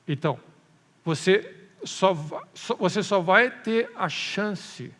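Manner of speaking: loud, insistent sermon delivery; emphatic preaching. Real, not acted. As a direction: press each phrase home to the congregation, loudly. Preaches with rising force.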